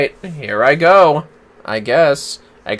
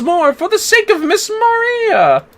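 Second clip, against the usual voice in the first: voicing a chivalrous hero's vow; earnest vow; 13 semitones higher, 4 dB louder than usual. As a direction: promise it grandly, stretching the name. Voices character's solemn vow.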